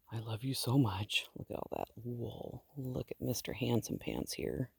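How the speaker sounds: soft, doting words to a sheep; tender pet talk. Real, not acted. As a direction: coo it gently and lovingly. Sweet talk to her sheep.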